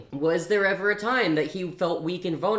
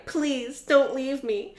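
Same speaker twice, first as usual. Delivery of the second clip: high, tearful begging; 9 semitones higher, slower than usual. Acted. Blakely begs Riot not to leave her. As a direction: beg him to stay. Heroine begging dying lover.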